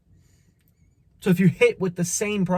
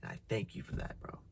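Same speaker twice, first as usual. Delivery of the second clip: meek quiet agreement; 19 dB quieter than usual. Retold or imitated. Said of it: "You're gonna be like".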